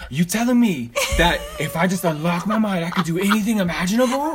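high, loud, pumped-up hype voice; hyped co-host voice. Retold or imitated. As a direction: do your friend all fired up and amazed. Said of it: "tell me if I sound like I gotta slay".

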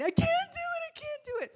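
mock high-pitched child's wail; screaming meltdown. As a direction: imitate a kid losing her mind, way up high. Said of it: "she screams, loses her ever-loving mind".

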